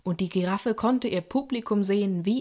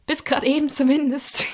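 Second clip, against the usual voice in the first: higher teasing giggle-talk; giggling; 6 semitones higher, 4 dB louder than usual. Acted. Little brother teasing his sister, giggling. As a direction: tease her with a cheeky giggle. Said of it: "kicherte er".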